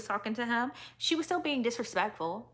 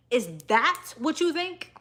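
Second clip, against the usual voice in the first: mock emphatic; 6 semitones higher, 4 dB louder than usual. Mocking emphatic imitation.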